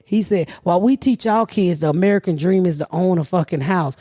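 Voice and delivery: ordinary delivery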